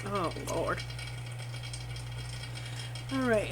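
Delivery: ordinary talk, nothing marked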